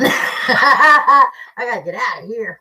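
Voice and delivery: laughing through the words, slowed down; real laughter breaking through the line. Real, not acted. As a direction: giggle it out while shaking off the anger. Laughing at her own anger.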